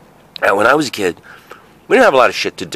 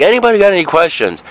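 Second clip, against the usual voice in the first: higher, fast teacher voice; teacher asking; 9 semitones higher, faster than usual. Retold or imitated. Museum teacher wrapping up the speech. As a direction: chirp it like a tour guide. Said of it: "So they're like".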